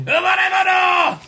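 hammed-up French accent; guttural French accent. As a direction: belt bonne avenue in a mock French accent. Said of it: "give me French words to say".

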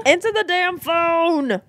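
high, very drawn-out fake demand; mock exasperated demand. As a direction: stretch the last word in mock impatience. Mock demand at a ringing phone.

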